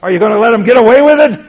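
high, loud, rushing protest; emotional plea. Retold or imitated. Souls in heaven demanding God avenge them, high and fast. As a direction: fire it up fast and high, indignant. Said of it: "that's highly emotional".

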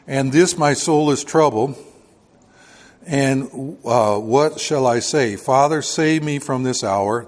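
loud, slow pulpit reading of scripture; preaching scripture reading. Acted. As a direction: declaim the verses to the congregation. Preacher reads scripture aloud.

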